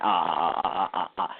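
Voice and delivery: a light mock of dipping tones; mimicking Chinese speakers dipping. Describing how outsiders hear Chinese, mock dipping ah. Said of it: "some people sounding like".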